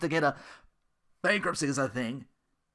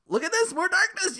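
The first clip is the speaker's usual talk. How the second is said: pitched way up, sarcastic announcement; mock exclamation; 13 semitones higher than usual. Sneering at the streamer with a high mock exclamation. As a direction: present the darkness like a gameshow prize.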